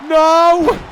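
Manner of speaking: a sudden loud cry of No; real panicked shout; anguished shout. Real, not acted. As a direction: yell it out as if it is all over. Reacting to a near fall.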